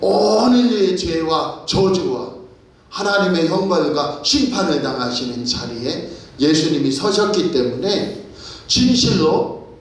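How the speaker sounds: full-force preaching. Acted. Preacher to congregation, fervent full-force preaching. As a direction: preach it with mounting passion. Sermon climax continues.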